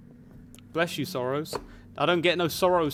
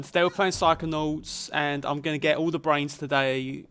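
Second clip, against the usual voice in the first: exaggerated Essex accent; 2 semitones higher, 5 dB louder than usual. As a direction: lay the Essex accent on thick, like a mate down the pub. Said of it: "Essex accents generally would be more like".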